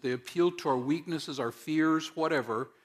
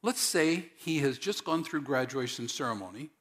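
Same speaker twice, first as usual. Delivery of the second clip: normal talking voice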